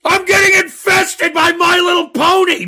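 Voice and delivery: outraged shout. Real, not acted. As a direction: hammer the complaint home. Host repeats his outrage.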